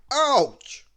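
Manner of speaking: high, loud yelp of pain; a sharp cry from a hammered thumb; loud ouch. Acted. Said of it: "As Mister Bump hit his thumb".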